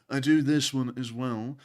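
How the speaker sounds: slow British accent demo; slow, put-on British voice. Shown as a demonstration. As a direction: show off a British accent, unhurried. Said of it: "We actually do several different ones".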